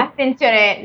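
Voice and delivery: ordinary delivery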